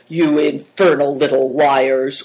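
loud, slow, drawn-out insult; narrated angry shout, held in pitch; shouted accusation. A narrator's reading. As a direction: hurl the insult in a rough character voice. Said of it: "would have liked to shout".

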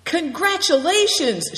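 loud, high cry of congratulations; shouted congratulations. A narrator's reading. Good Fortune congratulates the new rider, shouting. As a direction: call it out high and loud, drawn out. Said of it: "shouted Good Fortune".

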